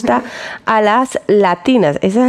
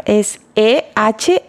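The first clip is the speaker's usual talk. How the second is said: spelling slowly; 3 semitones lower, much slower than usual. Shown as a demonstration. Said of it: "Ersam es con H intermedia".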